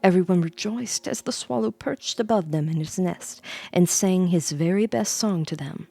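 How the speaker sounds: ordinary delivery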